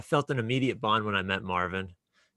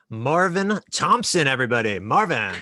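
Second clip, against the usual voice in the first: calling out welcome; 3 semitones higher, 7 dB louder than usual. Real, not acted. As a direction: draw out the name like an announcer. Host calls out welcome.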